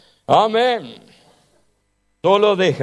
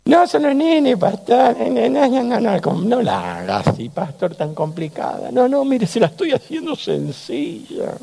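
put-on child voice; 8 semitones higher than usual. Children thanking the pastor.

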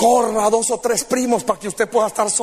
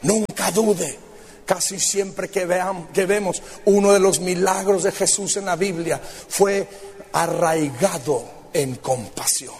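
tender preaching; 5 semitones lower than usual. Acted. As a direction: gently invite each listener in. Intimate altar appeal.

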